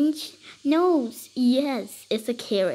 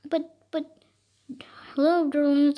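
whisper; 4 semitones higher than usual. Pretend. Mom character greets sweetly in pretend play.

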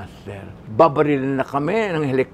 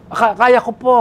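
forced bravado; 8 semitones higher, 10 dB louder than usual. Retold or imitated. Nervous actor answering director.

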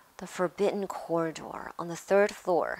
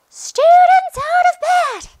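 shrieking high, full-volume alarm; bellowing; 17 dB louder than usual. A narrator's reading. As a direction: raise the alarm at the top of your voice. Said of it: "Peeves bellowed".

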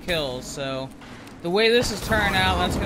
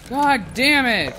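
higher, drawn-out frustrated swearing; angry curse; 5 semitones higher, 4 dB louder, slower than usual. Real, not acted. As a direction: stretch the curse out in exasperation. Curses after another death.

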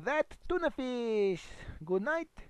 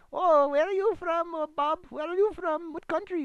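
caller voice; 8 semitones higher, 7 dB louder than usual. Retold or imitated. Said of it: "you've been calling going".

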